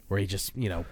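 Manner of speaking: ordinary talk, nothing marked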